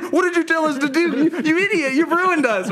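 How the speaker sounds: high-pitched mock panic; hammed-up frantic accusation; panicked outrage. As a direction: imitate a freaked-out team turning on you. Team freaking out, acted out.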